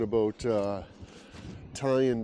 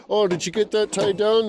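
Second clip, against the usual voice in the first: mock cheerful question; 9 semitones higher, 5 dB louder than usual. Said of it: "I'll be like".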